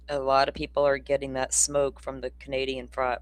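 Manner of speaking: ordinary delivery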